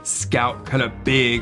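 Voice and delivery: usual speaking voice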